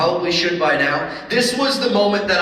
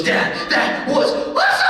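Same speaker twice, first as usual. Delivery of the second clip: very high, laughing cry of delight; thrilled kid. Retold or imitated. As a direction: squeal it like a kid off a ride. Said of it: "she goes".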